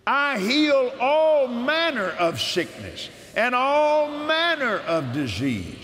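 slow, solemn reading of God's promises; measured devotional scripture reading; scripture proclamation. Acted. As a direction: proclaim each verse as a promise. Reads healing scriptures aloud.

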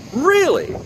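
sudden high disbelieving "Really?"; surprised. Acted. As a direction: jump way up in surprise. Tourist surprised by free frog.

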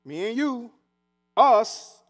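preacher driving home that God chose us; stress on us. Shown as a demonstration. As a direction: hit "us" hard, alone. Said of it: "I'm just gonna point that out".

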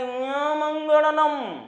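slow, high nasal yell of यमंगड़नम; nasal shout. Retold or imitated. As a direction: shout यमंगड़नम through the nose, stretched and high. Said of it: "बड़े जोर से नाक से चिल्लाया".

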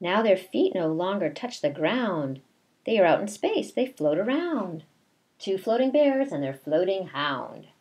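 slow, sing-song read-aloud; reading to children. A narrator's reading. Teacher reading a picture book to her class, slow sing-song. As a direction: read the rhyme slowly to the class.

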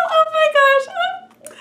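very high, slow thrilled squeal; excited squeal. Real, not acted. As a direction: gush over the package. Package just arrived, thrilled.

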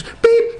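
very high, sharp electronic beep; scanner beep. Retold or imitated. Imitating the checkout scanner with a high beep. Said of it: "And it goes".

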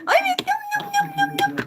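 tiny high voice yumming; high squeaky yum. Acted. Tatlıyı iştahla yiyen çocuk sesi. (ince tiz sesle yum yum)

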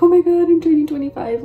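high, loud imitation of fretting peers; imitated dismayed peers. Mimicking people her age who dread turning twenty-five, mock dismayed. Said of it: "so many people my age are like".

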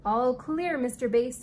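ordinary delivery

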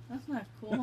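high, loud, mock-offended; excited disgust. Real, not acted. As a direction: protest the bad taste. Bad bean taste reaction.

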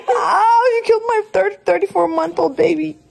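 high laughing yelps; laughing ow shout; laughing cry of pain. Real, not acted. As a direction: yelp in pain while laughing. Friend hits her.

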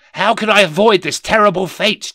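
a hammed-up despairing cry; desperate cry. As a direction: beg the ghost to save you. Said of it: "he cried".

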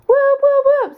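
high, loud whoops; cheering. Real, not acted.

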